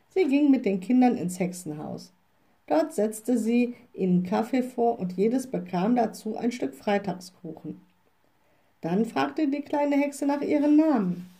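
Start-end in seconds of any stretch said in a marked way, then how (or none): none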